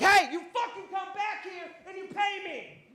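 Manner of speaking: high-pitched distant-sounding shout; accosting passers-by at a yell; angry yell. Retold or imitated. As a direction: yell after someone walking away, furious. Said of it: "he starts yelling and accosting".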